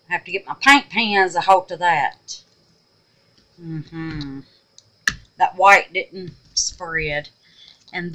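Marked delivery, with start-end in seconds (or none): none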